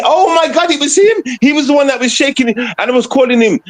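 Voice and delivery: high, thrilled, almost squeaking; excited. Real, not acted. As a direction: pitch jumps way up in excited disbelief. Excited recall of the concert.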